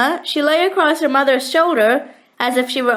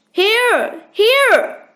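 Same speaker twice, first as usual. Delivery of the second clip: calling out; 8 semitones higher than usual. A narrator's reading. Lost family calling back.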